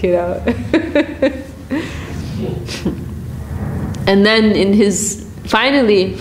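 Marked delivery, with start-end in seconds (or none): 0.5-4.0 long quiet breathy laugh. Real, not acted. Laughing at fleeing pandits.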